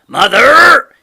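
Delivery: loud urgent shout for his wife. A narrator's reading. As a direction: call her from across the yard. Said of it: "he shouted".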